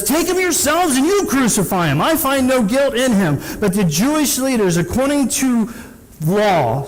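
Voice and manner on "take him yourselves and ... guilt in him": much higher, pleading governor; a hint of a fed-up Roman governor; exasperated Pilate. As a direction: toss the problem back at them. Pilate refuses to judge Jesus.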